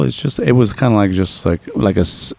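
ordinary talk, nothing marked

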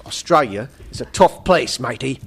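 put-on Aussie accent; Australian accent. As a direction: read it like an amateur Australian impression. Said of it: "how to do my Australian accent".

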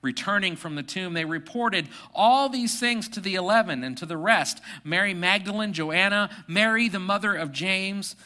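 reading the passage aloud to the congregation; measured, lifted Bible reading; scripture reading. Acted. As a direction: reads the Gospel to the church.

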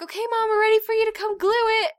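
put-on high fast little girls; kids voice. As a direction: chirp it like eager girls.

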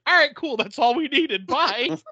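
high, loud, breezy dumb-cop voice; dim cops. Retold or imitated. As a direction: brush it off like a clueless cop, way up high. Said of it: "they're like".

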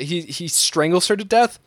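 normal talking voice

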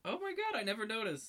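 high-pitched amazed realization; astonished, voice way up high; real surprise. Real, not acted. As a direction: react to a reveal you totally missed. Learns character is a woman.

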